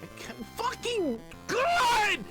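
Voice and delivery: slow, very high yell exploding at the end; exasperated scream. Real, not acted. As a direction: build into a frustrated scream. Screams at the game.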